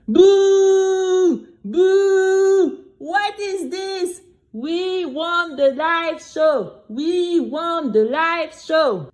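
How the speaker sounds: crowd booing high, then chanting slowly. Acted. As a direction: jeer like an angry audience. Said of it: "everyone in the audience started to boo him".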